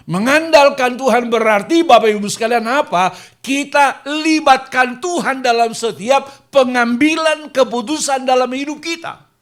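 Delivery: fiery pulpit shout; preaching at full force. Acted. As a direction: drive the point home to the congregation. Preacher hammering main point.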